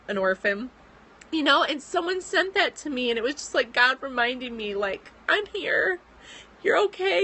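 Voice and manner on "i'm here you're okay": higher, tear-choked reassurance; tearful. Real, not acted. Vlogger voicing God's comfort to herself, tearful and higher. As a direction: comfort yourself through tears.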